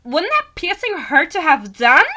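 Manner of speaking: high, loud, drawn-out questioning; hammed-up high girly voice, slowly asking. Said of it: "I think she talks like that".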